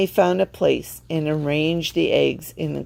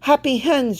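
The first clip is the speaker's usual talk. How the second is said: pointing it out; 9 semitones higher than usual. A narrator's reading. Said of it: "she said".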